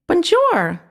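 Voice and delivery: high, bright mouse voice, French "Bonjour"; happy squeaky mouse. A narrator's reading. Mouse repeating the greeting, high and happy. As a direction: squeak it happily like a tiny mouse. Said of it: "the mouse repeated happily".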